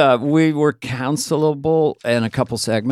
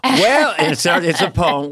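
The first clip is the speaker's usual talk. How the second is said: ordinary talk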